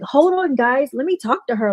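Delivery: mildly excited retelling; retold excitement. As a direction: cut in eagerly. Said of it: "So he was all excited".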